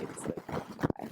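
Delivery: breathy whisper, very soft. Retold or imitated. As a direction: whisper it like a bored fireman. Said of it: "they were like".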